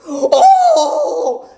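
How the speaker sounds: high, loud shocked laughing oh. Retold or imitated. The driver catching them face to face, high loud shocked oh. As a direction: gasp out a startled oh. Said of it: "this guy's like".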